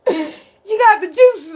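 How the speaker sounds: put-on voice. Playful put-on voice.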